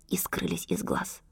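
hushed storyteller whisper. A narrator's reading. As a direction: whisper it secretly. Narrator whispers the vanishing. (таинственный шёпот)

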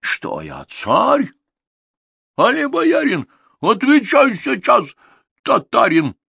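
high, loud imperious tsar demanding an answer; angry tsar. Acted. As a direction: bark at the servant like an angry king. Angry tsar questioning Ivan.

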